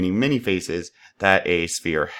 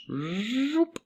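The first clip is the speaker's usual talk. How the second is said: very high, soft rising zoop; a whimsical whoosh for the push; playful zoop; 6 dB quieter than usual. Acted. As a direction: sweep it sky-high like a cartoon launch. Playful sound effect while extruding.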